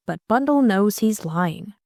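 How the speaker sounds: ordinary delivery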